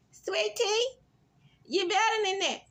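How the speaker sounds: high, put-on scolding voice; mocking Gregory. Vlogger voicing Gregory chiding Sweet Tea in confessional, high mock scold. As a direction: chide her from way up high. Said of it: "was like".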